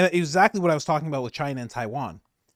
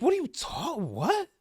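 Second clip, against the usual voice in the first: incredulous what; 11 semitones higher than usual. Retold or imitated. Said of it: "I'm like".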